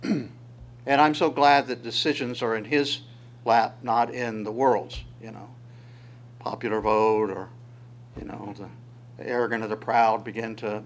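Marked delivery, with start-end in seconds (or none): none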